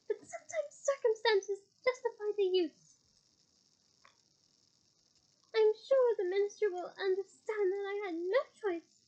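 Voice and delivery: high, sugary menace; Umbridge voice. Acted. Umbridge justifying the curse.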